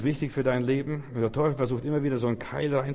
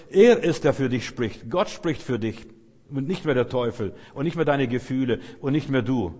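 loud, punchy pulpit declarations; forceful preaching; 5 dB louder than usual. Real, not acted. Preacher proclaims loudly that God now speaks for you. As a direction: hammer each line home.

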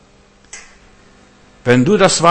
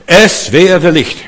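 a slow proclaimed command, louder and a touch higher; divine command; 5 semitones higher, 6 dB louder, much slower than usual. Acted. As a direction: speak it as a short creating word. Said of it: "so kurz".

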